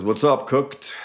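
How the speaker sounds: high, loud mocking greeting; ribbing coworker. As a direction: razz him like a cocky line cook. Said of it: "And he says".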